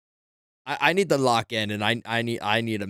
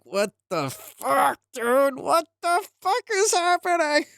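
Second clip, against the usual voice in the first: much higher, slow, stretched-out disbelief; climbing higher and higher in mock horror at the plot twist; shocked disbelief; 14 semitones higher, slower than usual. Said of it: "and you're like".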